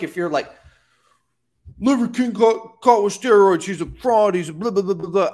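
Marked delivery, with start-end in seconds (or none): 1.8-5.3 high, loud whiny Karen complaint; mock an outraged Karen; shrill... hammed-up Karen rant. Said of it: "basically just being a Karen".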